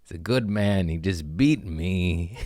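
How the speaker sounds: ordinary delivery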